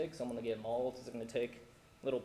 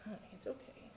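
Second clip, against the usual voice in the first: whispered reassurance; 11 semitones higher, 9 dB quieter than usual. Real, not acted. Father whispering to his toddler at the podium, soothing. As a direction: hush her gently, barely voiced.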